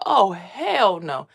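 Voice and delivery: mother hearing her son saw the blue star, slow and drawn out; drawn-out refusal. Retold or imitated. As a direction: stretch every word out in disbelief. Reacting to son's answer.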